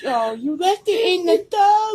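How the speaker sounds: singsong tease. Real, not acted. Teasing about the centipede.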